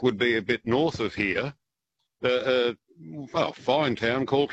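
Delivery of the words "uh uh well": ordinary talk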